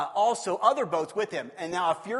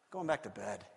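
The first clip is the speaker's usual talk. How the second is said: mumbled aside; lower, soft grumble; 12 semitones lower, 11 dB quieter than usual. Pretend. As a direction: drop low and soft. Grumpy Jesus goes back to bed.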